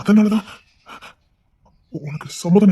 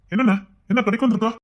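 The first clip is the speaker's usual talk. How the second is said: normal talking voice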